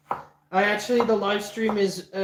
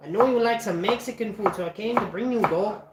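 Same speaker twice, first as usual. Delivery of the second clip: lightly put-on Mexican accent; 3 semitones higher than usual. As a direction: play a friendly Mexican guy offering food. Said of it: "you want me to do a Mexican accent".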